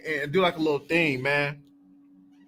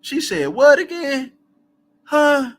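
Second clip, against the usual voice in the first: rising, loud, confused question; baffled by the accent in the audiobook, voice climbs; 8 semitones higher, 5 dB louder, slower than usual. Retold or imitated. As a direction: play it baffled, pitched up high. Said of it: "I'm like".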